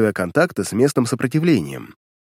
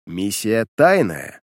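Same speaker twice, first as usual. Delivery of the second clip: slow, weighty stress on тайная; emphasised; 3 semitones lower, much slower than usual. Acted. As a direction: lean on тайная, slowly. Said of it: "акцентировал он внимание".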